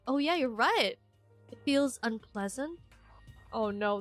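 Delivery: ordinary delivery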